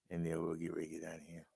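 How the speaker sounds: whispered sign-off. Acted. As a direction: whisper the goodbye quickly.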